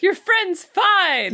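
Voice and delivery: high, stretched-out imitation of calling across a room; mock reassuring call. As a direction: jokingly call out the all-clear. Co-host voices the doctor calling out.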